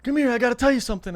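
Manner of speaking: high, loud, hurried come here; hammed-up urgent summons; mock urgent call. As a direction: fake an emergency to get them running. Urgently luring kids to trap.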